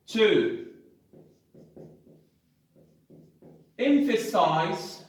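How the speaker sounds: the rule said word by word with long pauses; slow dictation while writing on the board; dictating so students can copy; dictation pace. Shown as a demonstration. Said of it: "write a very short summary here".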